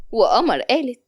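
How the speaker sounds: ordinary delivery, nothing marked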